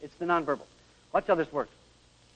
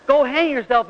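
louder, higher go hang yourself; emphatic retort; 7 semitones higher, 5 dB louder than usual. Shown as a demonstration. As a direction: hit hang hard. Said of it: "It's how she says it".

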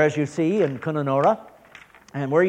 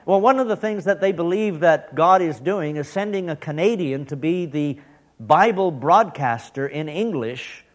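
ordinary talk, nothing marked

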